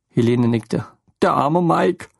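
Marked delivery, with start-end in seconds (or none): 1.2-2.1 higher, tearful motherly voice; upset motherly woman. A narrator's reading. Lene breaks the news to Theo, distraught. Lene's voice again.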